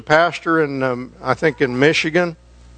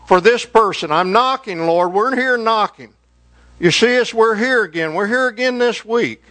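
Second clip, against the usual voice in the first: higher, insistent pleading to the Lord; earnest prayer; 4 semitones higher than usual. Shown as a demonstration. Praying parent comes back to knock on the Lord's door, earnest prayer. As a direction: pray aloud as if knocking persistently. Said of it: "And you say".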